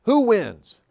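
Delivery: imitating a broker shouting a question; retold yell. Broker in the Chicago audience yells at speaker, mock shout. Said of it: "a broker yells at me".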